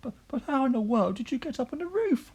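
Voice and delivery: very high, bewildered stammer; stammered cry. A narrator's reading. Mother asking Grandma on the roof, high-pitched cry. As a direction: cry it out high, tripping on the first word. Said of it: "cried the mother".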